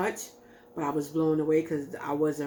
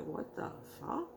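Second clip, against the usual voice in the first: barely-voiced whispered disbelief, pitched up; mouthed disbelief; 14 semitones higher, 11 dB quieter than usual. To her crying boyfriend, a stunned whispered disbelief. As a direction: breathe it out in shocked disbelief, almost soundless. Stunned reaction while looking at him.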